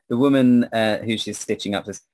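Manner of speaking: ordinary talk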